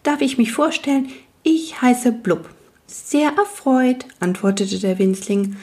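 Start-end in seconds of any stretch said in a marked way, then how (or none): 0.0-2.5 shy will-o'-wisp. Acted. Wisp introduces itself. 2.9-4.0 tiny man's voice. Acted. Tiny man answers.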